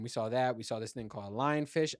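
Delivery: ordinary delivery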